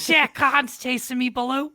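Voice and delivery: high, loud mock cry for help; hammed-up boy calling out to Baloo; panicked Mowgli.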